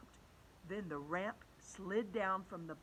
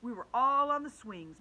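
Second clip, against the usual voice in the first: ordinary delivery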